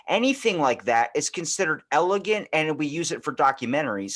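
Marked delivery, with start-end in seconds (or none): none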